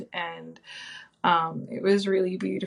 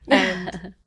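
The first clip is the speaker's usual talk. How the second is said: usual speaking voice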